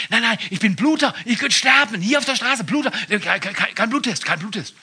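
high, loud, frantic refusal; panicky drunk excuses. Retold or imitated. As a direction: protest in a panicked stammer. Drunk driver pleads with cop.

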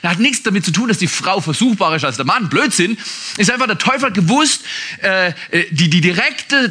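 ordinary talk

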